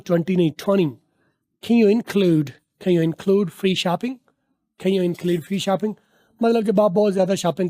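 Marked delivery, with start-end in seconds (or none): 1.6-5.9 slow model sentence. Shown as a demonstration. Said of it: "slow model sentence".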